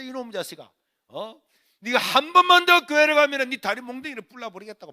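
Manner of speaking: threatening shout, swelling loud and high; cursing mother. Retold or imitated. Mother forbidding son to go to church, cursing loudly. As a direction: build into an angry threat. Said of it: "이렇게 욕을 하고 있는데".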